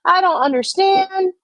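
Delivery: high, mock-clueless whine; playing innocent. Muscles feigning confusion to Tiffany, high and whiny. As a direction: act dumb, pitch way up and helpless. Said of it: "And Muscles was just like".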